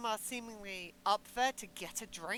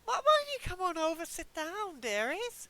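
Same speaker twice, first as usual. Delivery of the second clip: friendly barkeep; 7 semitones higher than usual. Acted. Skeleton invites the newcomers.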